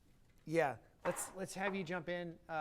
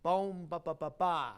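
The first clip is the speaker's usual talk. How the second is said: loud "Bum" flowing through to the last "ba"; shaped phrase; 8 dB louder, slower than usual. Shown as a demonstration. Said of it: "there's a shape to it".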